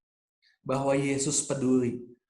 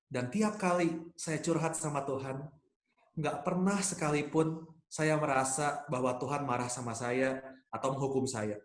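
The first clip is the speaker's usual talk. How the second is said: ordinary talk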